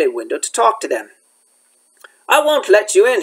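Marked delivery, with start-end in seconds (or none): 2.3-3.2 out of breath, higher; puffing out the words. Acted. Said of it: "he puffed".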